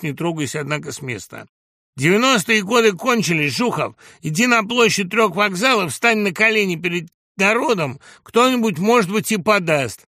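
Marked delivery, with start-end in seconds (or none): 2.0-10.1 high, loud heckling tirade; shouted taunt. A narrator's reading. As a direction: harangue the opponent in front of a crowd. Heckler's tirade in acted quarrel. (крикливая издевательская тирада)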